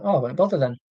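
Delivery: ordinary delivery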